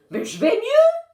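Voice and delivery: high, loud whiny protest; protesting child. A narrator's reading. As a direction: whine it like a little boy.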